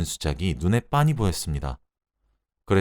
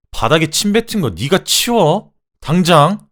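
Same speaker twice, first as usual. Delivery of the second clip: high, loud, drawn-out furious order; angry yell; 9 semitones higher, 8 dB louder, slower than usual. Acted. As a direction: yell the command at your husband in a rage. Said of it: "소리를 질렀어요". (화가 나서 내지르는 고함)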